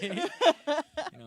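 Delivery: slow, high laughing question. Real, not acted. Joking with podcast hosts about Armani, high slow laughter. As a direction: laugh through it, stretched out slowly.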